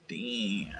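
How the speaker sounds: whispered. Real, not acted. Admiring his painted Gundam.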